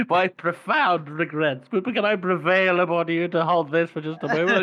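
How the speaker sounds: high-pitched, over-formal apology through amusement; smooth-talking bluffer. Retold or imitated. Co-host mocks Morvir baffling a stranger, high and over-polite. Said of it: "baffle people with his words".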